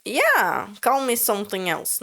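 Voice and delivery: ordinary talk, nothing marked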